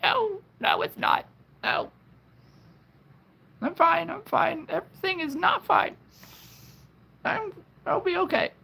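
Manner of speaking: high, wobbly fake-crying voice. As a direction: whimper it out like you're about to cry. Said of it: "It's my crying voice".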